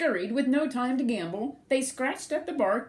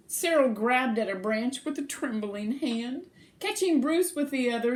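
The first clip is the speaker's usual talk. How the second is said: plain reading voice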